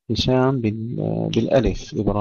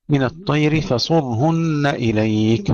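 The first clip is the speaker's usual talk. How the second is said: slow melodic tajweed chant; Quranic recitation; 5 semitones higher, slower than usual. Shown as a demonstration. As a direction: recite it slowly, drawing out the vowels. Recited verse. (تلاوة مجودة بطيئة)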